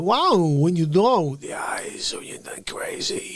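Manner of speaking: slow Walken drawl fading to a whisper; Walken impression. Doing Walken for the interviewer, slow then whispered. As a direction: stretch it out like Walken, then breathe it. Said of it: "but, but".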